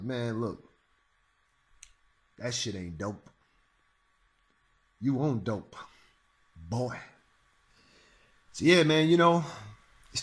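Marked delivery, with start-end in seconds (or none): 0.0-7.0 much lower, much softer, cool and dismissive; deadpan low-voiced dismissal; low muttered put-down. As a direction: mutter it low like you're unimpressed.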